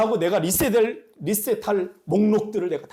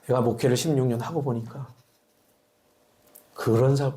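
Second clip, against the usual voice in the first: ordinary delivery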